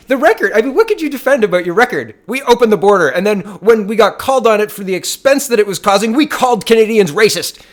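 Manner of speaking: loud, high-pitched outrage; worked-up, high and loud tirade; incredulous rant. Real, not acted. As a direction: raise your voice in disbelief. Incredulous outburst at the record.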